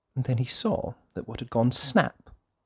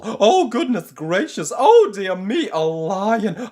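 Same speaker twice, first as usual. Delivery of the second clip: high, loud frantic babbling; panicked squeal; 7 semitones higher, 11 dB louder than usual. Acted. Mister Jelly imagining a lion, in rising panic. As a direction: spiral into hysterical panic.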